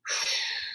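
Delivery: quiet exhale, barely voiced; small discreet sigh for the bus; soft calm sigh. Shown as a demonstration. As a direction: breathe out gently and subtly. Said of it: "And you wanna go".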